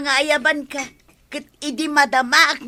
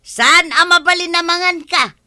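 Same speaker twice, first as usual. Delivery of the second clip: angry scolding; 3 semitones higher, 7 dB louder than usual. Acted. Couple quarrel over work.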